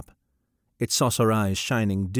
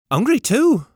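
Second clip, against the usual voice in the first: put-on young woman character voice; 14 semitones higher, 7 dB louder than usual. Gallowglass speaks.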